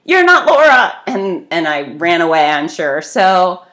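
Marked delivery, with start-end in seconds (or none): 0.0-1.0 much higher, louder, slow flustered sorry; startled apology. Retold or imitated. Apologizing to a stranger at the elevator, startled and flustered. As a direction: blurt the apology, caught off guard. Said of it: "deer in headlights".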